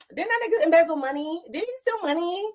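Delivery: high, gossipy mock whisper-shout; a partygoer spilling tea in a high gossipy voice; nosy gossip voice. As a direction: play the messy partygoer who smells tea. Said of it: "You would be embarrassed ... be like".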